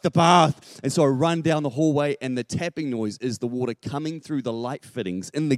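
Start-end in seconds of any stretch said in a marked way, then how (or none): 0.0-0.8 loud, higher mock-horrified echo; alarmed realisation. Father echoes son on the flooded bath, loud alarm. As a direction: blurt it out as it dawns.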